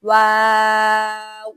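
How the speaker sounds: واو drawn out very slowly and loudly; letter waw stretched out in a slow, loud Qur'anic model; demo pronunciation. Shown as a demonstration. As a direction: hold the واو long and full, as a model for students. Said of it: "کوشش کریں اس طرح ہی ادا کریں".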